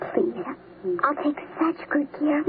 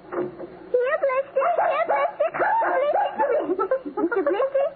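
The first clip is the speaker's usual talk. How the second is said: calling puppy; 9 semitones higher than usual. Acted. Girl calling her puppy.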